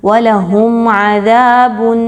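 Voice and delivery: slow, drawn-out recitation; slow tajweed recitation; Quranic recitation. Shown as a demonstration. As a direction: chant the word slowly as in tilawat. Teacher recites the verse.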